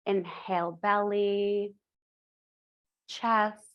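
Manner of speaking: drawn-out words with long pauses; slow the breath cues down. Shown as a demonstration. Teacher slowing the class's breathing, unhurried guided cues. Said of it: "try to slow it down".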